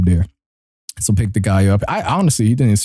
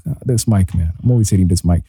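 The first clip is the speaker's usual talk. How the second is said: ordinary talk, nothing marked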